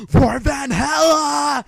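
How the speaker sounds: ragged, all-out "For Valhalla!" yell; battle scream. Shown as a demonstration. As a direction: bellow it like a warrior charging. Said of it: "you scream Valhalla when you're doing it".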